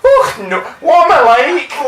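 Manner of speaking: high, bashful, self-mocking voice; vocal shrug, coy and high-pitched. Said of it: "he appeared to shrug at the audience".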